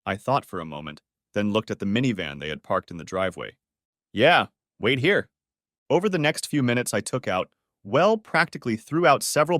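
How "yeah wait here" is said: curt. Acted. Bitter reply to the friends.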